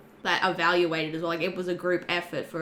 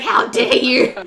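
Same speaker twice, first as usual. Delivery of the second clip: loud, high indignant gasp; mock outrage; 3 semitones higher, 9 dB louder, slower than usual. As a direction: play offended, overacted. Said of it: "She got very upset".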